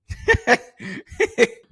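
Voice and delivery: sudden high burst of laughter. Real, not acted. Laughing at the silly cake.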